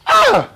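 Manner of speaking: loud, very high joyful cry; father's loud high "Ah" of joy. Acted. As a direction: cry out at the sight of the boy. Father sees his son.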